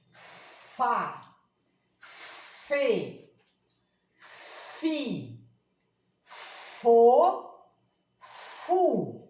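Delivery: "Fá, fê, fi, fo, fu" each syllable spoken apart with a pause; syllable family. Shown as a demonstration. Teacher showing the children the f family, syllable by syllable. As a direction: sound out the f syllables one by one for the class. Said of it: "Então, olha lá".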